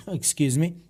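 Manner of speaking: rough, croaky throat; hoarse voice. Real, not acted. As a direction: talk through a sore, hoarse throat. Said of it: "I do sound like a dying walrus".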